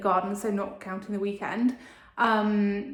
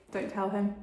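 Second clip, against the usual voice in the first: normal talking voice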